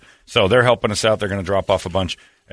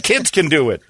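ordinary talk

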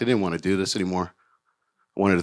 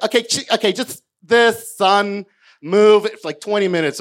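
pushy producer; 10 semitones higher, 5 dB louder than usual. Retold or imitated. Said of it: "then he's like".

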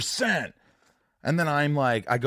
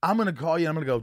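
ordinary delivery

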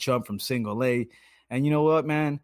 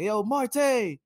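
high, raised call across the ballpark; heckling call; 11 semitones higher than usual. Retold or imitated. Fan calling to the Pirates left fielder, high raised call. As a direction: hail him from the bleachers. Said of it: "I used to be like".

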